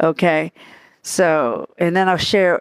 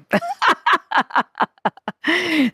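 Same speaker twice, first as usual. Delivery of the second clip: high laugh; 12 semitones higher than usual. Real, not acted. Laughs about accents.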